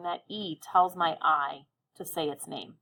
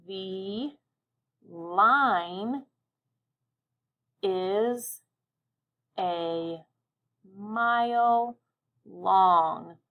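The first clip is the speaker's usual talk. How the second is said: each word spoken alone with long pauses; one word at a time; much slower than usual. Shown as a demonstration. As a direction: pause after every word as you draw. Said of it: "say your sentence while you write your lines".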